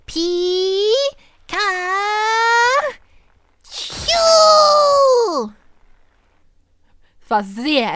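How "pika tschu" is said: high squeaky Pikachu call; Pikachu cry. Acted.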